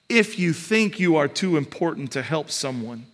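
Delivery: ordinary delivery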